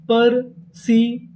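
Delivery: Perseverance said slowly, syllables spaced; slow model reading; slow full word. Shown as a demonstration. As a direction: unveil the whole word slowly. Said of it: "पूरी meaning क्या बनी".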